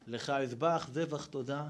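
ordinary delivery

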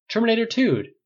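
high, loud shout of Terminator two; shouted pick. A narrator's reading. A friend shouting his movie pick, high and loud. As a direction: yell the title.

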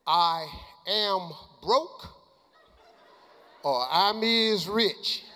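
"I am broke" then "I'm is rich" drawn slow; slow contrast. Shown as a demonstration. Asking the coach which sounds best, slow and teasing. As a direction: weigh each phrase slowly. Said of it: "Which one of these sound best to you".

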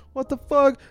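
high, loud alarmed yelp; shocked Sam. Retold or imitated. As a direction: squeak it out in alarm. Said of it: "Sam's like".

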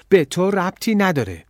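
high, loud defiance; angry retort. A narrator's reading. Girl swinging a bottle at café owner, angry. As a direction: throw it back angrily.

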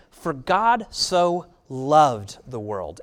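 very slow, deliberate reading; slow scripture quote. Acted. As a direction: lay out each word slowly. Quotes verse slowly.

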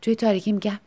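usual speaking voice

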